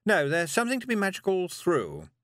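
a busy, slightly nervous witch; Miss Tick. A narrator's reading. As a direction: witch talking a girl into a walk. Miss Tick's voice.

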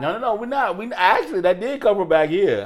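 loud insistent. Real, not acted. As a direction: insist loudly. Insisting the noise was real.

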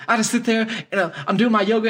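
high, put-on talk show guest voice; mock meditation guy. Imitating radio talk show guy on meditation, high mock voice. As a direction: imitate a silly yoga devotee.

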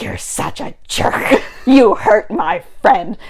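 slow, drawn-out mock outrage; acted scolding. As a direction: scold word by word, with a laugh breaking in. Acted scolding, slow, laughing midway.

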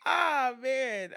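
normal talking voice

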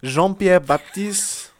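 put-on loud, high, drawn-out French accent; mock French accent. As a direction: stretch the name out in showy French. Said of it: "Why'd you do it in a French accent?".

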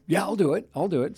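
a keen colleague saying yes to the show; eager yes. Retold or imitated. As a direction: jump at the chance, keen. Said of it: "he goes".

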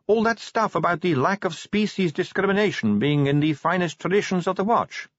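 high-pitched vampire recruit; Sally. A narrator's reading. Sally argues with Vimes.

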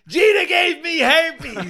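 high laughing yell, slow; laughing shout. Pretend. Joke voice, laughing.

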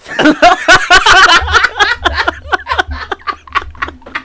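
long, high, loud laughing fit; real breathless laughing fit; uncontrollable laughter. Real, not acted. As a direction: crack up helplessly at your own joke. Cracks up at own Garfield line.

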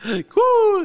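a loud, high whoop; high mock cheer. Retelling the speeding, a high whooping cheer. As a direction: whoop like he's hitting the gas.

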